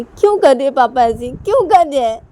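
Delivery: high, loud, plaintive daughter; upset protest. Acted. Daughter protesting Papa's office burden to mummy, loud whine.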